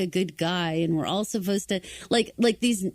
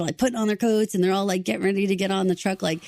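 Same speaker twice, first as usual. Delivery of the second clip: ordinary talk, nothing marked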